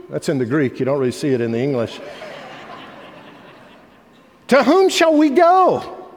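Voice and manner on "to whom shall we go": high, slow pleading question; earnest Peter. Retold or imitated. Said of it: "Simon Peter had answered him".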